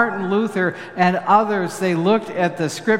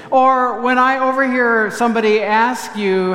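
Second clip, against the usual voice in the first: ordinary delivery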